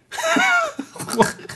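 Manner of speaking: a sudden high-pitched laugh; high burst of laughter. Real, not acted. To the host after saying cornholing, a high laugh.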